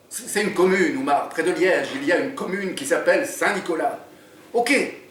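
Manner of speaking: lively read-aloud narration; story reading. A narrator's reading. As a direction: read the chapter to draw listeners in. Author reads his book aloud.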